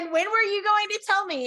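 high-pitched mock confrontation; imitating an affronted lover, pitched high; indignant demand. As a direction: demand an answer in hammed-up indignation. Voices heroine confronting her mate.

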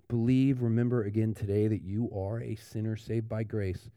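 ordinary talk, nothing marked

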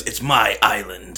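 slow, drawn-out Irish movie line; lightly put-on Irish brogue; quoting the movie line. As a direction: say it slowly like the film character. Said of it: "he goes".

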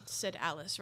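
ordinary delivery, nothing marked

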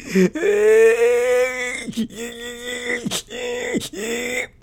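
long, high, loud mock wail; hammed-up sobbing that breaks into gasps; bawling wail. Speaker re-enacting crying alone in the car, bawling wail. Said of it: "bawled my eyes out".

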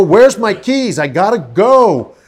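high-pitched, insistent holler; mock urgent demand. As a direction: call it out to rouse her. His routine for rousing wife.

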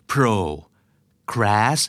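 pro, crash as two separate syllables with a long pause between. Shown as a demonstration. Teacher sounding out procrastinate for listeners, syllable-by-syllable demo. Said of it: "ออกเสียงนะครับ".

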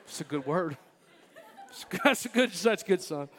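chuckling through the words; proud laughing approval; laughing praise. Real, not acted. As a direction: laugh while praising your son. Praising son while laughing.

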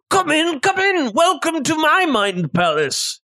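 high, eager host welcoming guests; chef character voice. Acted. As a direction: greet them warmly as the eccentric chef.

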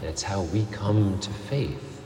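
ordinary talk

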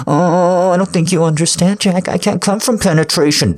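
high, loud mocking voice; mocking imitation. Partner mocks Jack's protest, loud high taunt. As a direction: throw his words back in a high, loud taunt.

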